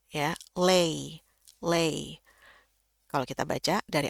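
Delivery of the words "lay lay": "lay" prononcé avec la diphtongue ei, bien tenu; syllabe "lay" isolée. Shown as a demonstration. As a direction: faire sonner "lay" en ei, pas en a. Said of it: "satu suku kata dia paling naik nadanya".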